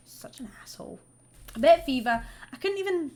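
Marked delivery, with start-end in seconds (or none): 0.1-1.0 breathy whisper under the breath; whispered insult. Real, not acted. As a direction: hiss the insult to yourself.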